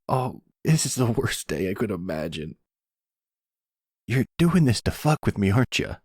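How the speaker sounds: the son building from despair to challenging the boss; whiny outrage. Acted. Danny confronts boss angrily.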